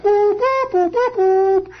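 high, drawn-out tooting; imitated horn call. Storyteller imitating horns ending the day's battle, high toots. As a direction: toot a slow bugle signal. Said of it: "the horns blow".

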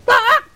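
a piercing, very high and loud raven caw; raven screech. Shown as a demonstration. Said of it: "I top of my voice".

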